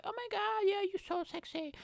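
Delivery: put-on high gushing girl; desperate girl. Girl flattering the comedian, high swooning voice. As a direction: swoon over him.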